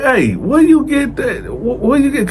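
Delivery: high, incredulous where you get that; sudden high-pitched double take; surprised question. Retold or imitated. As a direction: pitch up in disbelief. Surprised questioning, much higher.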